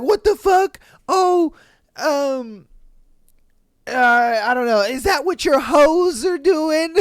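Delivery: high, loud outraged shriek; mock angry wife. Wife outraged at husband's honesty, high and loud. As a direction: blow up at him in mock outrage. Wife getting mad, mimicked.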